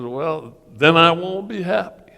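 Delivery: high, loud defiant ultimatum; loud protest. Retold or imitated. To staff planning graduation, loud high refusal. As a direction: plant your feet and refuse.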